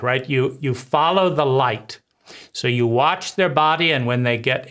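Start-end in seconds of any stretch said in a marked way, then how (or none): none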